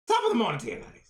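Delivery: put-on high, loud stage Irish accent; hammed-up Irish leprechaun greeting. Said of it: "You just sound like the leprechaun".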